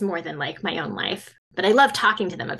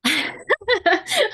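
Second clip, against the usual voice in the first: burst of laughter; 13 semitones higher than usual. Real, not acted. Laughs at motion picture question.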